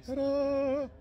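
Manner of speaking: high, bright showman ta-da; ta-da reveal. Real, not acted. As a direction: present it like a magic trick. Presenting the signed item.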